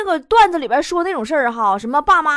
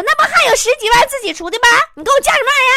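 nagging mom; 11 semitones higher, 6 dB louder, much faster than usual. Retold or imitated. Nagging mom scolding son.

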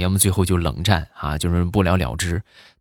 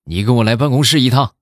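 mildly stern, raised-pitch summons; stern teacher; 5 semitones higher, 4 dB louder than usual. Retold or imitated. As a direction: imitate a teacher turning serious. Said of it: "一下就严肃下来".

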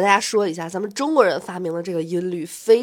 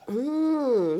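ordinary talk

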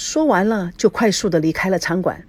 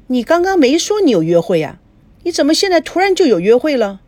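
higher-pitched, put-out mother; questioning mother; 8 semitones higher, 5 dB louder than usual. Acted. Mother questioning daughter's sudden date, higher and put out. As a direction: challenge the sudden excuse.

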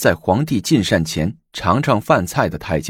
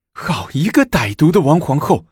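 through gritted teeth; 7 semitones higher than usual. A narrator's reading. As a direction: spit out 王皇后 in fury. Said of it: "武则天咬牙切齿地说". (咬牙切齿地说“王皇后”)

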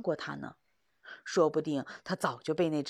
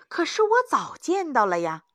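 desperate protest; 9 semitones higher, 7 dB louder than usual. Acted. Young hunter protests.

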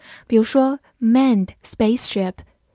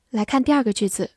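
ordinary talk

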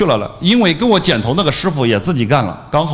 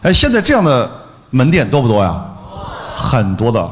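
ordinary talk

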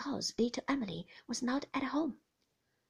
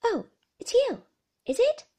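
put-on much higher, louder girlish voice; teasing girl; 14 semitones higher, 11 dB louder than usual. Emily teases David after he catches her, pert girlish voice.

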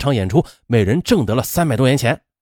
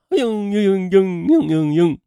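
stylized crying, drawn-out whimpers; mock whimpering sobs; 3 semitones higher, slower than usual. A narrator's reading. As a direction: imitate a girl's whimpering cry. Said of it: "哭了起来". (模仿嘤嘤哭泣)

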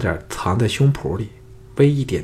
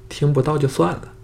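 higher, pouty brush-off; sassy, willful; 6 semitones higher than usual. A narrator's reading. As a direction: toss it off like a spoiled girl. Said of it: "撒野道". (撒娇耍性子，音调偏高)

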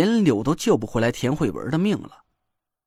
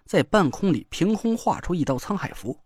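plain reading voice

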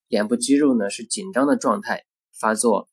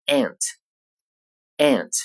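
"ant" with a flat front "a", as in "cat", American style; American pronunciation. Shown as a demonstration. Teacher giving the American "ant" after the British. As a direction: say "ant" the American way, mouth spread. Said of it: "美式英语中发作".

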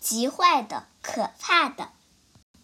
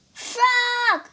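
much higher, louder call; crying out; 11 semitones higher, 4 dB louder than usual. Acted. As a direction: call for a friend across the pond. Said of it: "cried the thing".

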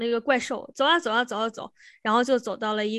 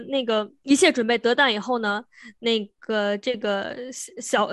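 ordinary talk

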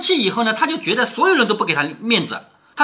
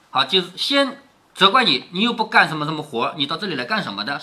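ordinary delivery, nothing marked

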